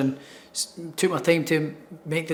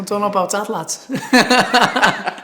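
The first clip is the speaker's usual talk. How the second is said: higher, hammed-up Scouse accent; playful Liverpool accent, pitched up; Scouse impression; 5 semitones higher, 5 dB louder, much slower than usual. Shown as a demonstration. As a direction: put on jokey Scouse, breaking into a laugh. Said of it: "Can you do the impression?".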